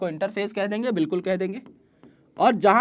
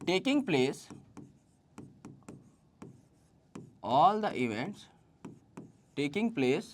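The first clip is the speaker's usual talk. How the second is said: slow, soft, word by word with long pauses; slow dictation; 7 dB quieter, much slower than usual. Acted. Teacher dictating the cell cycle definition to students, very slow. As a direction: let them write every word down.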